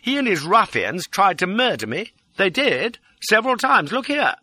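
high, urgent outpouring, often loud; distraught pleading. A narrator's reading. Fugitive begging Lola for help, distraught. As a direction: plead wildly for rescue. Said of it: "The distraught pleading line".

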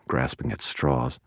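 ordinary delivery

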